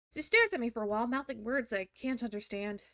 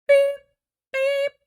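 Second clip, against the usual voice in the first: high beeping; 14 semitones higher, 14 dB louder than usual. Retold or imitated. Imitating noise.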